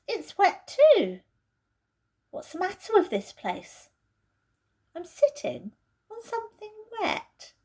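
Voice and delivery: Peter's voice, puzzled. Acted. Peter realises he is sitting on something wet, puzzled. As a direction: stumble over the words in surprise.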